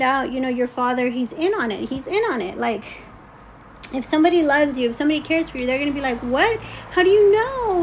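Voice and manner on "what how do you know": high, loud mock alarm; startled, demanding questions; freaking out. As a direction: act out someone reacting in shock. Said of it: "I would freak, right?".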